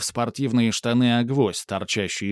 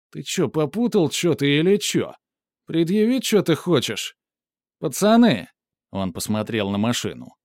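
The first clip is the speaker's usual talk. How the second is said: calls to his mates, spoiling for a fight; russian street thug; 6 semitones higher than usual. A narrator's reading. Thug threatening, calling mates.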